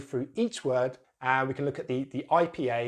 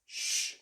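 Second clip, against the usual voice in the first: long voiceless "shh"; hushing shh; 3 dB quieter than usual. Shown as a demonstration. Teacher demonstrates end sound, long hushing shh. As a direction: shush as if asking for quiet. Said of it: "asking someone to be quiet".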